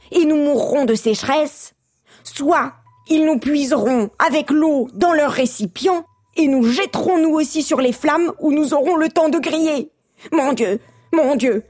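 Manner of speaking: high, mounting despair; frantic prophecy of doom; doom-struck frog. A narrator's reading. Third frog foretells their doom.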